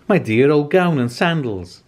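overjoyed to find his clothes; excited yell. A narrator's reading. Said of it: "he yelled".

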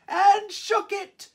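high, strained push on the shake; straining to shake it; effortful shake. Acted. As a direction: strain as if shaking a big bush hard. Shaking the bush harder.

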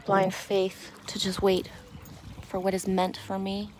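normal talking voice

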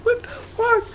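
very high, squeaky What the fuck. Pretend. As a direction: squeak it way up in falsetto. Mocking girls in photos.

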